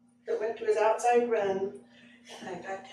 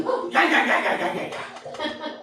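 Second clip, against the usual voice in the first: mock dog snarling; 5 semitones higher, 5 dB louder than usual. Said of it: "Brutus was right behind me going".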